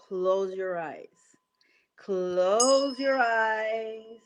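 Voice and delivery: slow, calm meditation voice; guided meditation. Acted. Coach guides the group into a breathing meditation. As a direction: ease them into breathing, very slowly.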